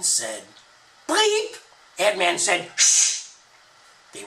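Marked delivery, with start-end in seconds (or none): 1.1-1.6 very high, sharp squeak; playful high-pitched Eve; squeaky bleep. Acted. Even names a word playfully. 2.8-3.3 a forceful hushing hiss; husband hushing his wife; loud shush. Acted. Ad Man hushes Even.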